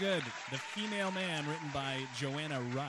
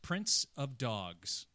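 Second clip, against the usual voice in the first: slow quiz title read; 4 semitones lower, much slower than usual. Acted. Reads quiz title slowly.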